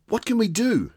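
a hint of a young boy asking gently; concerned boy. A narrator's reading. Oscar voiced by narrator.

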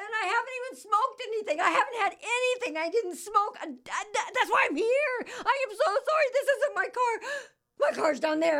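high, fast, laughing explanation; laughing frantic apology. Retold or imitated. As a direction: babble through embarrassed laughter.

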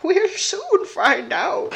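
high, slow sly queen voice; scheming old queen. Acted. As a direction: play the queen plotting. Queen's line read again voiced.

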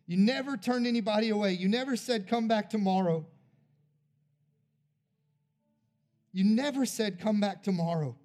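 a pastor's altar-call prayer, swelling; fervent prayer. Acted. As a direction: pray over them with building conviction. Closing prayer over the people.

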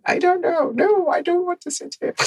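put-on very high, small Dutch-accented voice; little Dutch accent. Twelve-year-old refusing to sit among spiders, Dutch accent. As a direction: imitate your younger self with a squeaky Dutch accent. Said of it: "with my little, like, Dutch accent".

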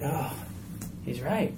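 soft, resigned self-doubt; defeated inner voice. Retold or imitated. Believer hearing Satan's lie, quietly defeated. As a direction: murmur it like giving up. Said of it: "He wants us to think".

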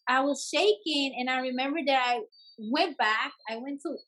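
ordinary talk, nothing marked

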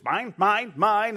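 high, loud squawking birds; mock seagull chant of mine; seagull cry. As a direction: squawk it like a greedy gull. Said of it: "those birds that just".

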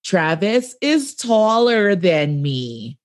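spoken very slowly and carefully; slow, deliberate enunciation. Retold or imitated. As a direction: spell it out slowly for confused friends. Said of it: "slow down and say".

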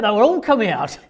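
ordinary talk, nothing marked

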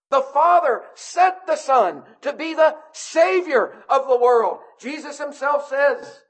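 high-pitched, ringing proclamation of scripture; fervent preaching. Real, not acted. Preaches at full force.

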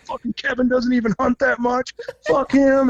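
mock-sulky jealous rant; pissed off. Hunter on his left complaining about the guide, mock angry. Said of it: "was so pissed".

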